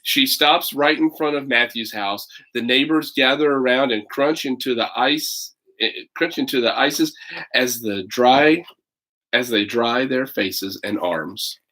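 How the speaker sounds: put-on lively read-aloud for children; story narration. A narrator's reading. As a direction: tell it like a picture book to kids. Reads picture book aloud.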